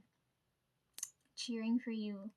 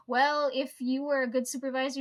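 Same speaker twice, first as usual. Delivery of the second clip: louder, mock confrontational comeback; retold indignant retort; 3 semitones higher, 8 dB louder than usual. Employee retelling her comeback to a supervisor, indignant and loud. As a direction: hit back hard, retelling the argument.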